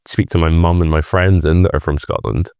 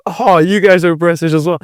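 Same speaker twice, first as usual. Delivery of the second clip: mock excited tourist; 9 semitones higher than usual. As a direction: play the delighted Brit abroad. Mimics meeting Brits abroad.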